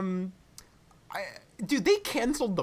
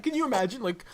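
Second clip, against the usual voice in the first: normal talking voice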